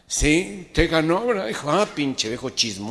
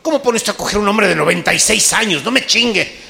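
loud, higher indignant pleading; outraged protest; 6 semitones higher, 9 dB louder than usual. Acted. Grandson loudly protests to his grandmother.

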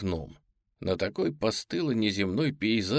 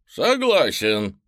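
slow, louder dinosaur drawl; lazy raptor agreeing; 4 semitones higher, 6 dB louder, much slower than usual. Acted.

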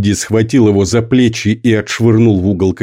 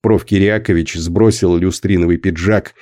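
plain reading voice

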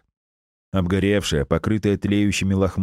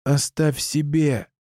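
desperate plea; 8 semitones higher, slower than usual. Acted. Pleading with dying uncle.

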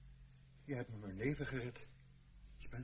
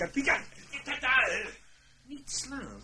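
panicked pleading; 13 semitones higher, 9 dB louder, slower than usual. Acted. Pleading not to be hit.

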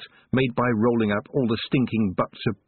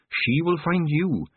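each word slow and weighty; slow and deliberate; 8 semitones higher, much slower than usual. A narrator's reading. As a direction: spell it out slowly, insisting. Repeated slowly to make her listen.

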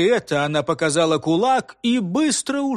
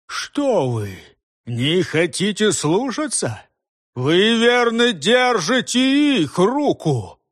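louder, slow, indignant berating; furious tirade; 4 semitones higher, 3 dB louder, slower than usual. A narrator's reading. Head berating disobedient constables, furious. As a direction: tear into the rebels.

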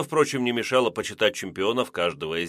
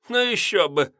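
tipsy high giggle; drunken giggle; 10 semitones higher, 3 dB louder than usual. A narrator's reading. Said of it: "пьяно хихикнула Мэри". (пьяное хихиканье)

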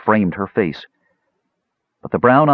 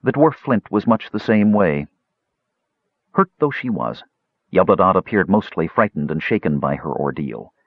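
ordinary delivery, nothing marked

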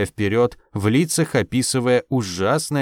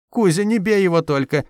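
mother crying out high; wailing plea; 7 semitones higher than usual. A narrator's reading. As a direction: wail it out in alarm. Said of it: "заголосила маманя". (мать голосит, высоко)

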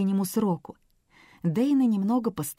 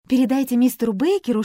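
hurried; 5 semitones higher, 6 dB louder, faster than usual. A narrator's reading. Dana to guard.

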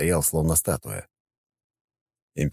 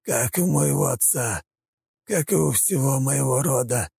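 high, strained weak plea; pleading prisoner; 9 semitones higher than usual. Acted. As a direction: beg with fading strength. Prince continues his plea.

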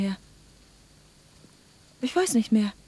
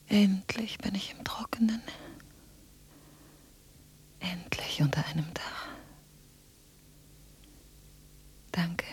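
soft, voiceless words, growing softer; whispering; 3 semitones lower, 7 dB quieter than usual. Acted. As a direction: whisper with relief. Anna whispers, finally sheltered. (erleichtertes Flüstern)

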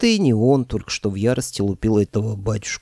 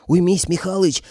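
yelling; 8 semitones higher than usual. A narrator's reading. Koschei yelling at the old man swinging a mop. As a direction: shout to make him stop. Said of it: "заорал Кощей".

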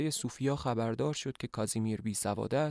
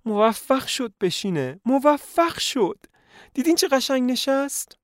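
high, loud, slow thrilled squeal; overjoyed shriek; 13 semitones higher, 7 dB louder than usual. Acted. Doctor's wife over daughter sitting, high delighted squeal. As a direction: squeal with delight like proud parents.

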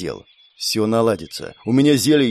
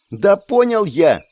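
shouted; 8 semitones higher, slower than usual. A narrator's reading. Andrey snaps at the narrator, shouting. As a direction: yell it back, fed up. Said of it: "выкрикнул Андрей".

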